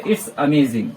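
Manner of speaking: low, awed "It's amazing" with a falling end; amazed exclamation. Shown as a demonstration. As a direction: say it like you can't believe how good it is. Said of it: "last में आता है हमारा exclamation mark". (हैरानी से "It's amazing")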